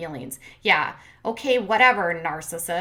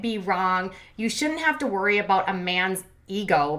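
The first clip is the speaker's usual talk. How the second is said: ordinary talk, nothing marked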